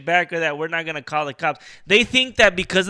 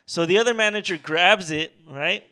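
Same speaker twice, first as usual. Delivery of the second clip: normal talking voice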